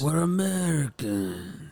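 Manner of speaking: slow, drawn-out mock Italian accent; Italian accent imitation. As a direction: mimic a movie Italian accent, stretching the words. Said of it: "That's what he sounded like".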